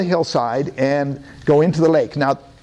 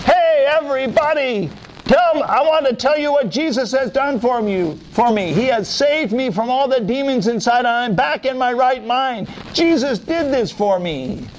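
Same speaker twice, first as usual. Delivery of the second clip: much higher excited announcement; joyful healed man; 8 semitones higher than usual. Acted. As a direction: call out the good news. Healed man tells the city.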